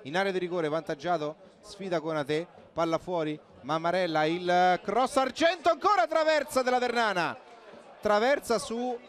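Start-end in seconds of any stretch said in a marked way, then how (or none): 4.3-9.0 high loud rising call on the chance; excited commentary. Real, not acted. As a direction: shout the near miss. Near miss in live commentary. (telecronaca concitata sulla traversa)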